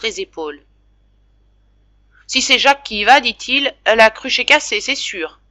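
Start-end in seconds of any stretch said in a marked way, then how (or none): 2.3-5.4 scoffing father. Acted.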